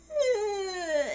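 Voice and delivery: half-hearted crying whimper; mock sob. Girl crying at the prince, a hammed-up whimper. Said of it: "she's like".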